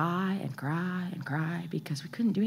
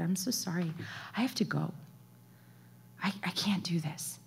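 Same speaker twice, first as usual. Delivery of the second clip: much softer, defeated admission; hushed apology; 12 dB quieter than usual. Retold or imitated. Mother to worship leader, bowing out, hushed and apologetic. Retold apology before leaving stage.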